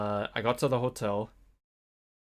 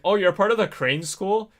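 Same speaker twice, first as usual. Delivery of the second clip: bus driver's raised, louder incredulous question; mock surprise; 9 semitones higher, 8 dB louder than usual. Bus driver asks the lone student, mock surprised. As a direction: imitate a surprised driver, pitched up high.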